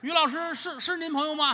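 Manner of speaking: high, loud startled asking; startled question. Acted. Doctor startled to hear the patient is a friend, high and loud. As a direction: jump at the news.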